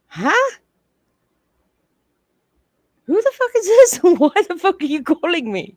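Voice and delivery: high, loud mock outrage; indignant phone answer. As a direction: play an annoyed woman picking up an unwanted call. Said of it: "She's gonna pick up the phone and say".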